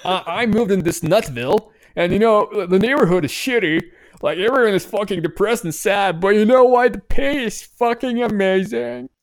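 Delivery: over-the-top happy tech worker; mock cheerful voice.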